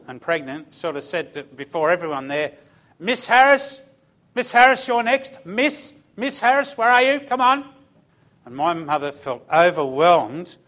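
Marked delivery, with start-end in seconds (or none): 3.0-7.7 high, loud, impatient summons; cranky nursing sister. Acted. Nursing sister calls a single pregnant woman before everyone, cranky and loud. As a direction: call out sharply like a cranky clinic nurse. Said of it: "very cranky disposition nursing sister".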